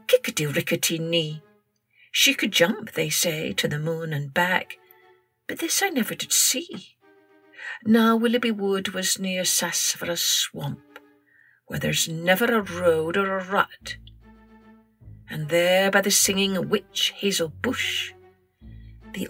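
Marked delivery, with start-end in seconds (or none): none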